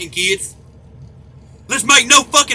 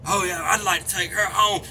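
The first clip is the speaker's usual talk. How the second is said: creepy mimic; 5 semitones lower, 7 dB quieter than usual. Mimicking perverts fishing.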